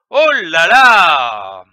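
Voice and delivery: slow, loud, drawn-out Oh là là; astonished. Shown as a demonstration. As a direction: marvel at something unexpected. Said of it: "pour exprimer un étonnement".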